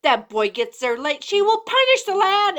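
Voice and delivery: put-on cat argues the boy must go, high; bossy cat warning. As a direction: warn like a fussy cat. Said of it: "I heard one of 'em say".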